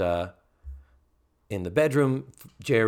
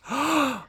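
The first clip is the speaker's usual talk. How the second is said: mock gasp; 14 semitones higher than usual. Tootie gasps in the scene.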